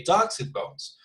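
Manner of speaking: DUCKS pitched high, the rest falling away; Betonung auf DUCKS. Shown as a demonstration. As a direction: punch the word Ducks at the front. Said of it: "emphasize on either one".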